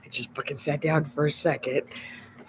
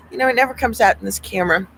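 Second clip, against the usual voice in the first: ordinary delivery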